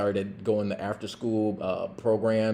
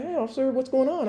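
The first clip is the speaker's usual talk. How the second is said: concerned mom; 14 semitones higher, faster than usual. Retold or imitated.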